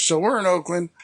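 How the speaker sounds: usual speaking voice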